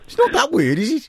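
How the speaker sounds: mock objection in a Birmingham accent. Host defends caller's fajitas to co-host, Birmingham accent. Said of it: "I'll do Birmingham".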